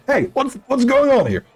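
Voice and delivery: high, shocked squeal of a bickering animal; startled animal. Acted. To the other animals, a high startled squawk.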